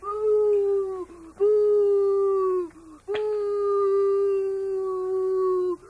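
loud excited oohs. Pretend. Cheering the match.

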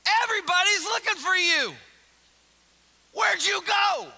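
anxious loud exclaim. Acted. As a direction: call out in alarm. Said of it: "they exclaimed".

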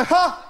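one sharp high "Ha!" then nothing; a lone high laugh, cut off; mimicking the friend's deadpan laugh; single bark laugh. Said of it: "they go".

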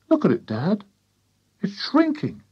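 put-on high, wondering boy; amazed young boy. A narrator's reading. George showing Dad the hen, high amazed voice. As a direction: tell Dad in a high boy's voice.

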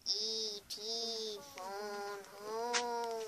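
very slow, drawn-out alien voice; slow alien creature impression. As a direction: stretch every word out like a film alien. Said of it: "I can also do E T".

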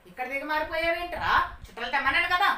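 acted loud parental reprimand; scolding father. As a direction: tell the son off as a cross father. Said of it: "అని చెప్పి తిడుతున్నాడు నాన్న".

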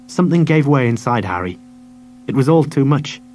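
ordinary delivery, nothing marked

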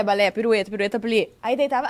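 usual speaking voice

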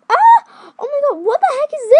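very high, loud shriek, fast; surprised shriek. Real, not acted. Opening a surprise toy, a loud, fast, high shriek. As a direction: scream in shocked delight.

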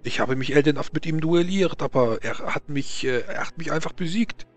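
put-on stammering Frenchman, heavy French accent; nervous French nobleman voice.

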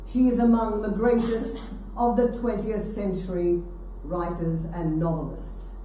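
ordinary delivery

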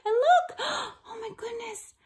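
put-on gasping, high-pitched alarm for children; wide-eyed storyteller's dismay, pitched high; mock shock. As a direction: gasp and exclaim over the drama. Storyteller reacts to duck nearly run over.

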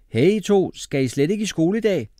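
loud, high-pitched mother's call. A narrator's reading. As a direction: shout to the children from another room. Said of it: "råbte mor inden for stuen".

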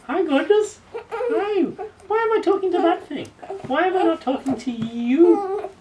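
playful high coo to win his attention. Real, not acted. Mother cooing at her baby instead of the camera, in high baby talk.

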